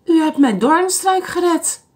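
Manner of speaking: a lightly voiced happy grandma; storybook old lady praising him; joyful old lady. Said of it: "riep de oude dame".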